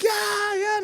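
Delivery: high mock yell of yes; excited yell. Telling the family he met the TNT boy, high mock yell. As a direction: yell it back like bragging. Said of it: "And I'm like".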